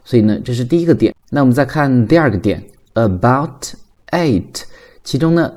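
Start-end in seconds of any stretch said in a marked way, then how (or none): 2.9-4.6 "about it" slowly, word by word; careful separate words in "about it"; unlinked words. Shown as a demonstration. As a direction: keep "about" and "it" apart. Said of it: "其中呢，它是在连读".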